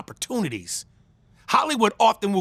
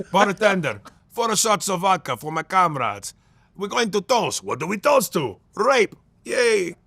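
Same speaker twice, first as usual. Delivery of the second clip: put-on Russian accent. Comedian mimics a Russian ordering vodka, in a hammed-up Russian accent. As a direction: boom it out like a Russian at the bar. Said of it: "Russian accents".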